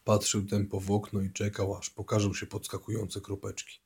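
ordinary delivery, nothing marked

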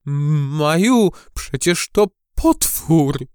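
voiced stage whisper of dread, drawn out; trembling fear. A narrator's reading. Gucio tells Maja it is a monster, trembling. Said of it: "szepnął Gucio drżącym głosem".

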